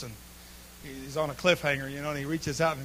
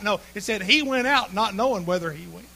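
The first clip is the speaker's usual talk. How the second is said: normal talking voice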